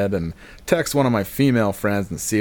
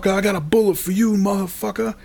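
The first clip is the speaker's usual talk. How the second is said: high, fast threats, not really shouted; rattled-off mock tirade, pitched high; mock yelling; 10 semitones higher, much faster than usual. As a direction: imitate a thug yelling after someone. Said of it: "He kept yelling at me".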